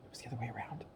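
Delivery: whispered aside. Acted. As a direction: whisper it secretly.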